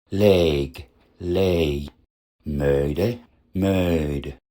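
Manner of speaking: Loge, Dröge, Lege, Möde, then Log, Drög, Leg, Möd with stretched diphthongs; overlong diphthongs. Shown as a demonstration. Teacher shows overlength in diphthongs, stretched demo words. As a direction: drop the final e and draw out the diphthong, voiced ending, as Leg. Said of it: "trifft übrigens auch bei Diphthongen auf".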